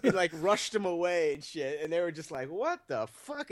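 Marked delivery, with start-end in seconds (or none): 2.5-3.5 shocked disbelief. Retold or imitated. Friends' shocked reaction retold.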